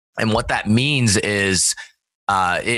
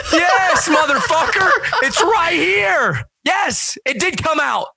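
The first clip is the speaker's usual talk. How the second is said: much higher, louder laughing mock shout; mock yell; 3 dB louder, much slower than usual. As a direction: yell it at the doctor, half laughing. Retold exasperated reply to doctor.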